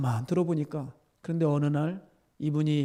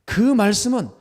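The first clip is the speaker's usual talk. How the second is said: loud, high, forceful exhortation; ringing, urgent pulpit delivery; impassioned preaching; 9 semitones higher, 8 dB louder, slower than usual. Real, not acted. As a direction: preach at full force to the congregation.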